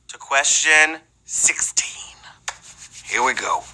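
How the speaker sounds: hushed whisper. Acted. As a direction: drop to a whisper. Host drops to whisper.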